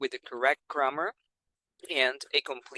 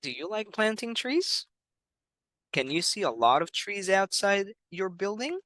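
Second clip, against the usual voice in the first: put-on higher, bright questions to a child; teacher asking kids; 6 semitones higher than usual. Shown as a demonstration. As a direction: ask it up high like talking to a young pupil. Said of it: "for example".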